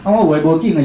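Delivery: usual speaking voice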